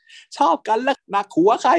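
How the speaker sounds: cheeky yell across the water; playful shout. Retold or imitated. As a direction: shout it at the sea, laughing. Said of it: "ทั้งคู่ก็ตะโกนลงทะเล".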